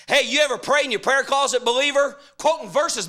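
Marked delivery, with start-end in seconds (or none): none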